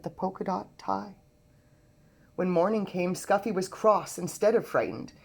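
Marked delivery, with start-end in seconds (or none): none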